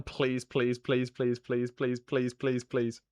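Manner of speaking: low, soft, rapid-fire begging; hushed, even string of pleas; pleading under breath. Real, not acted. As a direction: repeat it like a muttered prayer.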